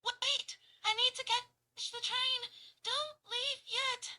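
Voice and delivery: high, loud, breathless pleading; out of breath. Shown as a demonstration. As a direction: gasp it out, out of breath. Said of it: "running out of breath".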